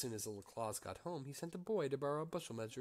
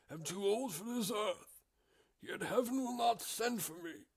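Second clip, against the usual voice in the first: put-on old drover; 9 semitones higher, 5 dB louder than usual. Old cattle drover speaking.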